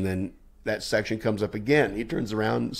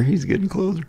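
high mock-panicked squeal; comic high-pitched panic; nervous squeak; 12 semitones higher than usual. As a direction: squeak it as dread closes in. Nervous retelling as he approaches.

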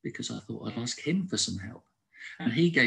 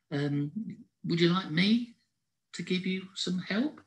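a lightly voiced hare, higher and gentle; thoughtful Howard; 8 semitones higher than usual. A narrator's reading. Hare gently offering his friend help with painting. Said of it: "So Howard thoughtfully said".

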